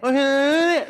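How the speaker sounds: high, loud shout over roaring wind. Retold or imitated. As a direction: yell back over the wind on a motorbike. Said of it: "kan suaranya kenceng kan". (teriak melawan angin di atas motor)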